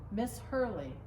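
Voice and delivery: plain reading voice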